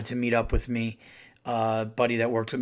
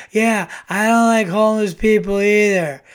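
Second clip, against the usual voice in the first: higher, louder punchline zinger; loud callback jab; 7 semitones higher, 10 dB louder than usual. Retold or imitated. Comic's callback aimed at the heckler, loud jab. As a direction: land the callback at him.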